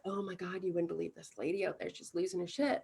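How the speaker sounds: hushed kitchen gossip; gossiping staff. Retold or imitated. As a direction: staff whispering about the lady. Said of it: "They're like".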